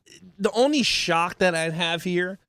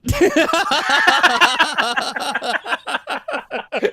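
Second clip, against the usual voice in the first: long loud laugh; 9 semitones higher, 6 dB louder than usual. Real, not acted. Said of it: "long burst of laughter".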